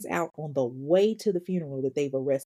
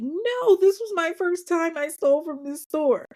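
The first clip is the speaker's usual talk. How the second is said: very high, protesting voice; mock innocent denial; 10 semitones higher than usual. Suspect denying past stealing to brother, mock-innocent. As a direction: imitate a liar protesting her innocence.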